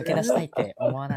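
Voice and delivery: loud, chummy recruiter, laughing; scammy recruiter. Acted. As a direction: lure the mark sweetly. Continues the acted recruiter.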